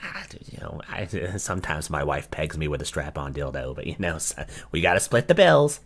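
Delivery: hushed smug mockery of the guy; smug husband parody. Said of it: "He looked so proud of that".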